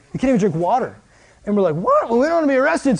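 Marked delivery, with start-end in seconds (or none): 1.8-3.0 high, startled yelp then rushed reply; lightly hammed-up alarm; mock surprise. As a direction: react like you just got caught. Retold shock at water ban.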